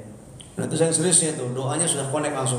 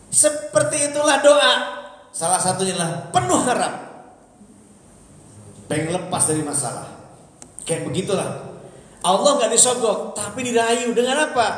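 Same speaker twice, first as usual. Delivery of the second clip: louder, higher sermon voice; preacher rousing the congregation; full-force preaching; 10 semitones higher, 4 dB louder than usual. Acted. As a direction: preach it out to the crowd.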